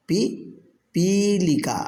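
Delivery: पिपीलिका drawn out very slowly with a falling end; slow model repeat. Shown as a demonstration. Teacher repeats पिपीलिका slowly for students to copy. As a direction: stretch पिपीलिका so the class can echo it. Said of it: "अब आप उच्चारण कीजिए".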